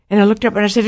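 normal talking voice

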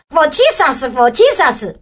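put-on high, eager "For Jesus" in Chinese-accented English; 带中文口音的英文; flustered English. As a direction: blurt "for Jesus" proudly in broken English. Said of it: "我就讲说".

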